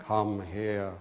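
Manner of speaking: low, soft and menacing; dropped scary voice. Shown as a demonstration. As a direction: drop the voice to scare. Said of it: "when you drop your voice, it can be quite scary".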